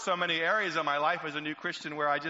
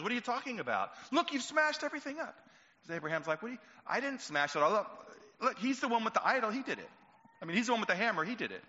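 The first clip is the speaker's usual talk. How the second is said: higher, playfully protesting innocence; a hint of an innocent son; 8 semitones higher, faster than usual. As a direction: point at the idol, feigned surprise. Son feigning innocence to father.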